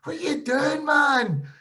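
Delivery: high, loud shout at a teammate; high-pitched shouted disbelief; mock shout. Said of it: "I just went".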